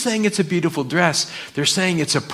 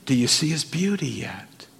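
usual speaking voice

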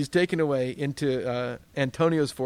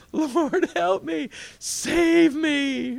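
high, loud pleading cry for help; desperate cry to God; 12 semitones higher, 7 dB louder, much slower than usual. Pretend. Preacher acting a believer's panicked plea, loud and high. Mock desperate prayer after falling.

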